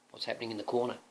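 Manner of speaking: ordinary delivery, nothing marked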